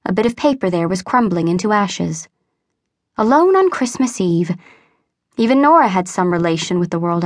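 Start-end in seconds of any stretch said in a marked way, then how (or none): none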